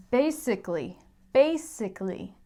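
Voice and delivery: basically said as BAY-sick-lee, no uh in the middle; four beats, bay-sick-lee; four-syllable basically. Shown as a demonstration. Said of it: "we say".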